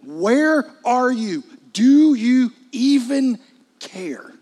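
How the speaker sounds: loud, high, slow, drawn-out demand; anguished shout upward, dropping away on the last word; desperate cry to heaven. Acted. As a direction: cry out at God, stretching every word. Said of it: "looked up and be like".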